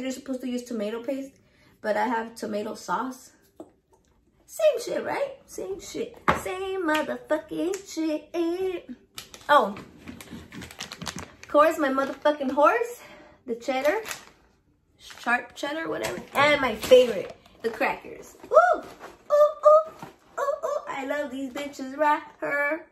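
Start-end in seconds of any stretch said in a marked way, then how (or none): 4.4-8.9 a much higher, playful same shit; high-pitched shrug. Real, not acted. To viewers, swapping tomato paste for sauce: high playful shrug. 18.4-20.8 high, loud delighted ooh; excited squeals. Real, not acted. To viewers, spotting her favorite crackers: high loud squeals.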